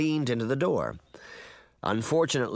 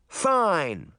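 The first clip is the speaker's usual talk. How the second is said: raised, high, falling cry; shouting back; 7 semitones higher, 3 dB louder than usual. A narrator's reading. Girl answering boy's refusal, shouting back. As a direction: snap back with a contained shout. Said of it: "Sabrina shouted back".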